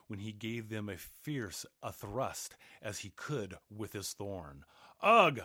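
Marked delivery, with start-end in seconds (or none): 5.0-5.4 loud, high bellow of pain; giant's pained roar. A narrator's reading. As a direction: roar like fifty mad bulls. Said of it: "roared the giant in a voice like fifty mad bulls".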